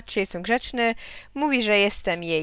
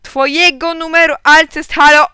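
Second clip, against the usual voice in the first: high, full yell into the phone; shouting; 7 semitones higher, 12 dB louder than usual. A narrator's reading. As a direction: shout to get through at last. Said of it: "krzyknąłem". (wysoki, donośny krzyk)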